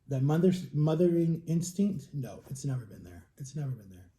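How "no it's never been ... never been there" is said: quiet sad. Real, not acted.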